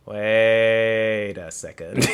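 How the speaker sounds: very drawn-out, comic realization; dawning realization. Retold or imitated. Tyler working out what vervain means, slowly dawning. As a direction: stretch the first word right out.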